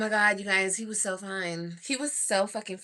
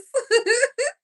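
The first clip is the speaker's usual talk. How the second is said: laugh; 7 dB louder than usual. Real, not acted. Laughing at herself.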